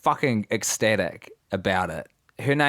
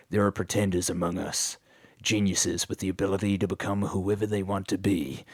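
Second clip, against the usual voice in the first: TV intro narrator; 3 semitones lower, 4 dB quieter than usual. Retold or imitated. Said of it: "it was like".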